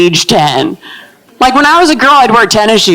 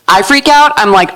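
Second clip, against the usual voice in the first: ordinary talk, nothing marked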